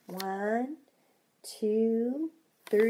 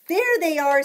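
excited; 5 semitones higher, 9 dB louder than usual. Acted. Children calling out excitedly.

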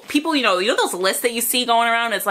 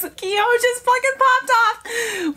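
much higher, laughing excitement; excited laughing; 12 semitones higher, slower than usual. Real, not acted. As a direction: gush through laughter. Excited about [name]'s vocals.

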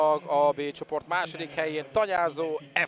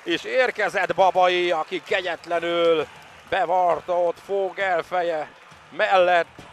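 loud, high goal shout; excited goal call; 7 semitones higher, 9 dB louder than usual. Real, not acted. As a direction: roar the goal, thrilled. Home goal in water polo.

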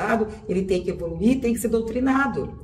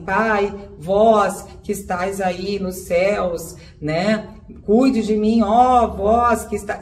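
slow churchy prayer voice, stretching every word; hammed-up pious address to heaven; mock solemn prayer; much slower than usual. Shown as a demonstration. Speaker mocks praying to her late father like a saint, slow and solemn. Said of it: "Eu não falo assim".

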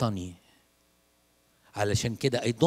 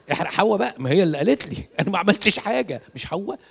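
أقول بصوت آدم وهو بيلوم حواء; يقلد آدم وهو بيرمي اللوم على حواء; mock blame; 5 semitones higher, 4 dB louder than usual. Mocking Adam blaming Eve. (نبرة تبرير مستهبلة)

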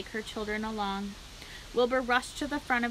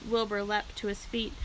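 ordinary delivery, nothing marked

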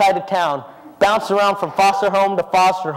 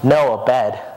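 lower, humble Michael; 8 semitones lower than usual. Retold or imitated. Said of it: "he turns to her and says".

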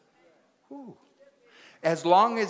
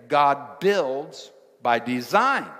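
"God builds by design" slow and louder, landing hard on "design"; slow emphatic line; 5 dB louder, slower than usual. Shown as a demonstration. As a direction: lay it down like a verdict, slowly. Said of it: "That's what an architect does".

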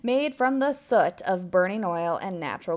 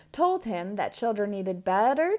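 plain reading voice

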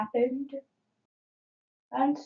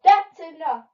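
loud, higher-pitched command; heroic shout; 5 semitones higher, 9 dB louder than usual. A narrator's reading. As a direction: shout it like a storybook hero. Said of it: "shouted Super Tato".

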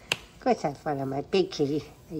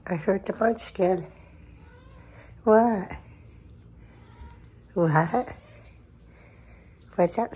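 higher, louder cooing call; 4 semitones higher, 5 dB louder, slower than usual. Real, not acted. Owner answering her meowing cat loudly, baby talk. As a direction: call back to the meowing kitty.

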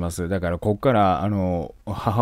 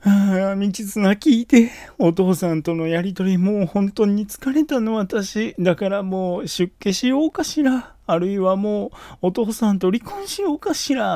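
higher, plaintive mother's voice; mother pouring out her tiredness to her boy; weary mother to son; 8 semitones higher than usual. Retold or imitated. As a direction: sigh out your troubles to your son. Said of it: "息子に言いますかっていうね".

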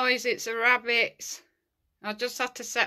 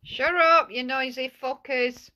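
loud, higher scolding shout; telling off; 4 semitones higher, 5 dB louder than usual. Real, not acted. Ghost hunter to noisy rabbits, shouted telling-off. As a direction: holler at noisy animals to shut up.

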